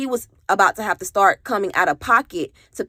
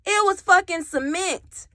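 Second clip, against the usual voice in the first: much higher outraged stress; indignant; 9 semitones higher than usual. Real, not acted. Telling viewers the floor was cement, outraged and higher. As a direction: hit it with disbelief.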